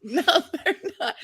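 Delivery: high, slow, laughing reply; laughing agreement. Real, not acted. Agreeing with April's joke, laughing.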